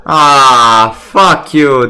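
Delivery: loud furious outburst; real loud anger; angry yell. Real, not acted. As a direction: swear at the dealer. Another loss at the table.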